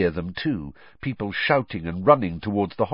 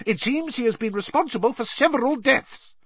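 blustering. Acted. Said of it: "blustered Fudge".

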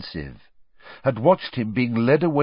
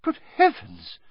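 very high gasped exclamation; a shocked teacher's cry; astonished. A narrator's reading. McGonagall seeing the man on the floor, high astonished cry. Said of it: "said Professor McGonagall".

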